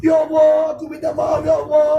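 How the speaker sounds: a repentant father crying out to his son; remorseful father's plea. Retold or imitated. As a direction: beg forgiveness, close to tears. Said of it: "sampai kita menangis".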